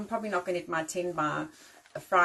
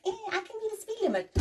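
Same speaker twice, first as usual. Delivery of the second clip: much higher, dim mock voice; mock dopey driver; 11 semitones higher, 4 dB quieter than usual. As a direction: play a clueless driver to ridicule them. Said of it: "everyone's in the other lane going".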